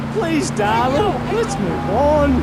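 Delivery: slow pleading. Acted. Pleading to change subject.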